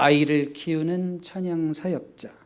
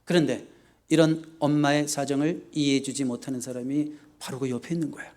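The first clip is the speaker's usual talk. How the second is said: normal talking voice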